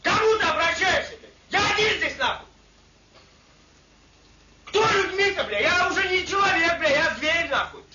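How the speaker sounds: high, loud, frantic yell with a cracking edge; furious yelling. Real, not acted. Soldier yelling at his cellmate, high and loud. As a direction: scream it like you're losing your mind. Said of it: "что вы орёте-то?".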